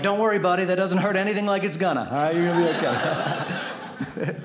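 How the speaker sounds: mock-soothing while chuckling; chuckling fake comfort; laughing reassurance. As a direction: comfort him through a laugh.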